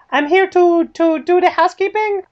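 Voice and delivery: high-pitched mock Spanish accent. Woman posing as housekeeper at the gate, Spanish accent. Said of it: "like, a, a Spanish accent".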